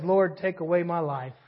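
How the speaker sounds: low, soft, sulky plea; pouting prophet. Retold or imitated. Preacher voicing Jonah begging God, low and sulky. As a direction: sulk like a sullen child wanting to quit. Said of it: "the pouting prophet".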